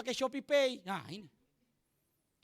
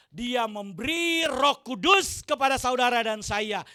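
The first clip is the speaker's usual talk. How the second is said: full-force preaching; 13 dB louder, slower than usual. Acted. Pastor exhorting the congregation with full force. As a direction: preach it out to the church.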